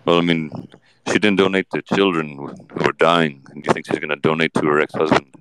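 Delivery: Johnny Depp. Acted. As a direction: answer low with thoughtful pauses. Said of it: "Johnny Depp is on the show".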